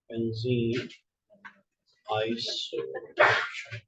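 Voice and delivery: low, soft, drawn-out syllables; dictating while writing. Real, not acted. Teacher writes out compound name for class, slow quiet dictation. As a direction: slowly murmur it as you write.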